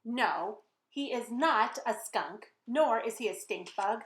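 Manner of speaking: ordinary talk, nothing marked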